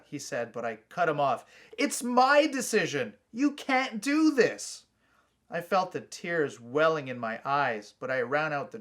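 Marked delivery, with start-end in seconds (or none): 1.8-4.8 high, loud, slow tearful protest; upset protest. A narrator's reading. Boy cutting off his brother, loud high tearful protest. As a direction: fight back the tears and insist.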